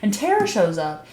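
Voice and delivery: ordinary talk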